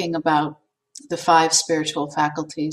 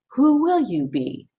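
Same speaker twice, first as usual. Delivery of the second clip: mild shout; 7 semitones higher than usual. Acted. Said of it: "he shouted from the half-open window".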